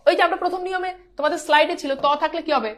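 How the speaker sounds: ordinary talk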